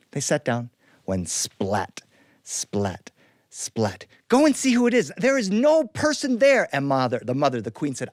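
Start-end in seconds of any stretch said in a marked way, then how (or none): 4.3-6.7 high, loud insisting; insistent princess. Acted. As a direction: push it, a bit frantic.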